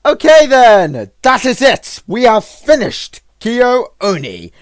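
loud, high, drawn-out declaration; big celebratory sign-off; triumphant announcement. Real, not acted. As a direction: proclaim it slowly to the audience. Announces game is finished.

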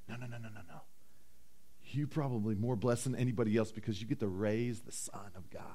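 low, very quiet soothing voice; acted calm, hushed comfort; soft reassurance. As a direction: gently hush away the worry as an angel. Acts the angel reassuring Joseph.

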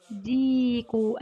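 "ديكو" with a long, held ee; "ديكو" said slowly, drawn out on the ya; lengthened madd letter. Shown as a demonstration. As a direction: stretch the first syllable of "ديكو": diiii-ku. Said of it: "نخرج حرف المد من هذه الكلمة".

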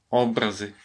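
образи stressed on the first syllable, О; образи as óбрази, stress up front. Shown as a demonstration. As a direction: say ÓБ-ра-зи, weight on the opening vowel. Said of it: "як поставиш наголос".

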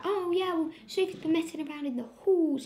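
high, brisk put-on teacher; mock head teacher. Girl imitating the head teacher's excuse, high. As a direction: rattle off the excuse lightly.

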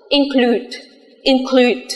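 "include" with a long drawn oo; teacher modeling "include" for the class; long u demo. Shown as a demonstration. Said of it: "Có thể đọc thành U dài".

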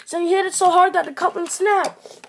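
plain reading voice

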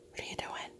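whispered to the pet. Real, not acted. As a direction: whisper it softly.

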